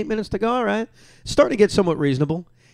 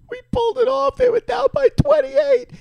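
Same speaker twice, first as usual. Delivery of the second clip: much higher, shouting with joy; ecstatic shout. Retold or imitated. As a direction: shout the news, overcome with emotion. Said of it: "with a tear in my eye".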